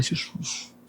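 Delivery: breathless whispered plea; a nervous whispered prayer under the breath. Retold or imitated. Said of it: "eu tava nervoso".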